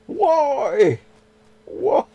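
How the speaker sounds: loud, high despairing why; exasperated cry. Real, not acted. Frustrated player yelling at the game, high and loud. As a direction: throw up your hands with it.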